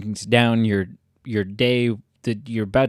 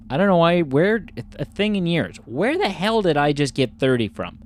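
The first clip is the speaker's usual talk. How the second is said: normal talking voice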